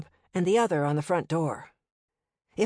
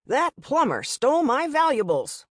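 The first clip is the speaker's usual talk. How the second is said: angry; 8 semitones higher, 3 dB louder than usual. Acted. Angry guest accusing.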